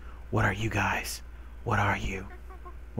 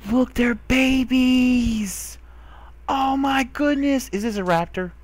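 excited squeal; 11 semitones higher, slower than usual. Real, not acted. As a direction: squeal over the babies. Finds baby raptors.

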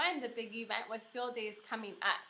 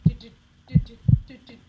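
loud, pounding heartbeat noises; loud drumming heartbeat do do do; heartbeat sounds; 18 dB louder, faster than usual. Acted. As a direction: thump out a nervous heartbeat. My heart was pumping.

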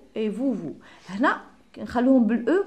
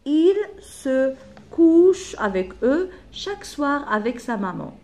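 loud, higher, each word spaced out slowly; slow dictation; 5 semitones higher, 6 dB louder, slower than usual. Shown as a demonstration. Teacher gives pupils the last animal line, slow loud dictation. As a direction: dictate "Il se couche" one word at a time.

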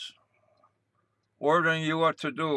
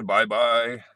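mock Obi bye-bye; 4 semitones lower, 5 dB louder than usual. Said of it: "Obi says".

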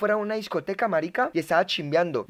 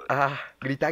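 ordinary delivery

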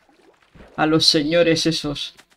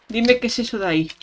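rushed, higher-pitched Dime; fast higher repeat; 5 semitones higher, faster than usual. Shown as a demonstration. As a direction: rattle it off quickly.

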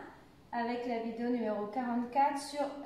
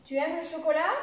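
higher pitch climbing to the end of "chocolat"; interrogative intonation; 4 semitones higher, 4 dB louder, faster than usual. Shown as a demonstration. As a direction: question rising high on CHOCOLAT. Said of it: "ça monte à la fin".

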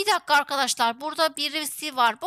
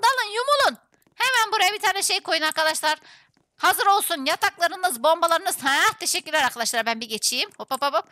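ordinary delivery

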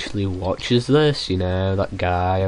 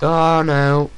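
dismayed; 6 semitones higher, 5 dB louder than usual. Real, not acted. Mistake happens.

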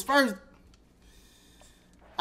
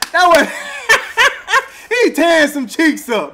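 laughing; 6 semitones higher than usual. Real, not acted. Laughing at a stream commentator.

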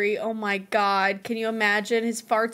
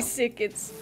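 ordinary delivery

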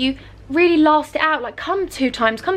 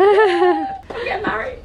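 high, slow and laughing with joy; overwhelmed joy; 8 semitones higher, 4 dB louder, much slower than usual. Real, not acted. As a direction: let the happiness spill over into laughter. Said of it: "Feel really emotional and overwhelmed".

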